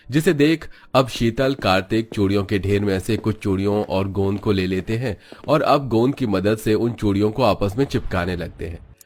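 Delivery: ordinary delivery, nothing marked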